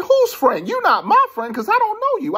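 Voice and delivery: high-pitched, loud, indignant pushback; acted-out outraged denial; indignant retort. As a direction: throw the claim back, voice way up. Retelling his rejection of the stranger.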